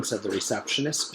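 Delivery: plain reading voice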